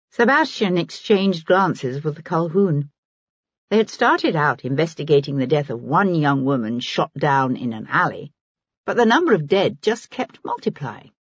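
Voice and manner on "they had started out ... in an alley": ordinary delivery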